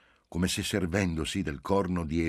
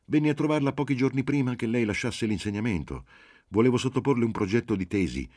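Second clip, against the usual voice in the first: higher, louder, pressing explanation; insistent; 7 semitones higher, 5 dB louder than usual. A narrator's reading. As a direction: insist eagerly to jog the professor's memory. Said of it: "insistette Marcello". (insistente, a voce più alta e forte)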